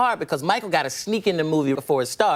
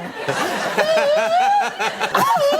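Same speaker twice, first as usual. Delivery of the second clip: long, very high mock scream; sudden sustained falsetto shriek; imitated falsetto scream. Prince suddenly screaming mid-chat, high mock scream. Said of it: "Why you screaming".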